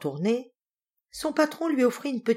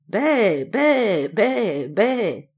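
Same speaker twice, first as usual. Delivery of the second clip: four drawn-out bleats, slightly raised; goat's "bé" cries, voiced by a storyteller; imitated goat bleat; 3 semitones higher, 4 dB louder, much slower than usual. Goat bleats after its answer.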